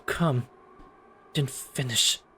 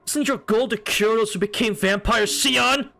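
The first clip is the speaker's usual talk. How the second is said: angry scolding; 7 semitones higher than usual. Acted. Scolding Shion loudly.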